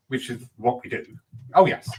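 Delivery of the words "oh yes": higher, louder eager yes; mock over-keen acceptance; eager agreement. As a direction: act out jumping at an offer. Mocks mediums' instant yes to bookings.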